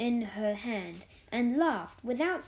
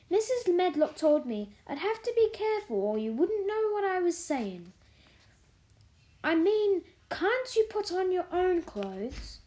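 higher-pitched, explaining Yorkshire housemaid; lightly voiced Yorkshire maid; 7 semitones higher than usual. A narrator's reading. Martha rewording her question for Mary, lightly voiced Yorkshire maid.

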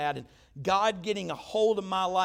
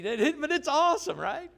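much higher, louder delight on "awesome"; excited burst; 9 semitones higher, 5 dB louder than usual. Real, not acted. Pastor telling the congregation how giving returns, excited. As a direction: let the joy jump out.

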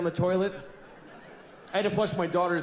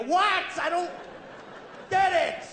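baffled outburst; 12 semitones higher than usual. Acted. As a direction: blurt out total confusion. Confused by mail.

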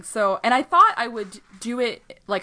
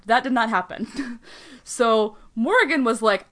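normal talking voice

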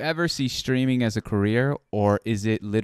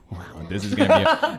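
laughing; 9 semitones higher than usual. Real, not acted. Laughing while reading a question.

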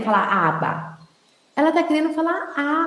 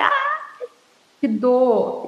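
retold pain cry; much slower than usual. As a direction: act out stubbing a toe. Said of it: "Eu falei".

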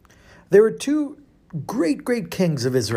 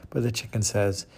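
usual speaking voice

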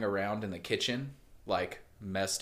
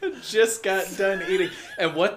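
laughing while telling; 10 semitones higher, 6 dB louder than usual. Real, not acted. Laughing at own gluttony story.

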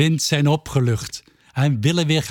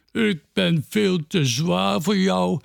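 character voice; 5 semitones higher than usual. A narrator's reading. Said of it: "zegt Peerke".

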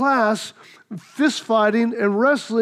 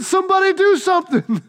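high, raised cry for help; pleading call; 8 semitones higher than usual. Retold or imitated. Child begging onlookers to stop fight, raised pleading cry. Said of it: "I said".

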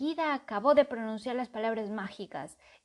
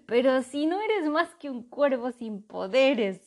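laughing; 4 semitones higher, 7 dB louder than usual. A narrator's reading. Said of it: "se rió Ratón".

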